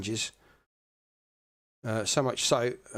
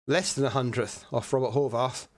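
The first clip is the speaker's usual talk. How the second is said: ordinary delivery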